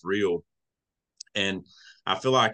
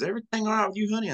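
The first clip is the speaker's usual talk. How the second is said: much higher, sweet helpful lady; much higher caring lady asking a stranger if he's okay; kindly lady; 12 semitones higher, faster than usual. Retold or imitated. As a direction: voice the caring airport lady up high. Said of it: "She says".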